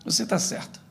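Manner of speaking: soft, near-whispered concession; hushed, resigned retelling; quiet giving in. Retold or imitated. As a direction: murmur agreement to end the quarrel. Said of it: "olha pra você e diz assim".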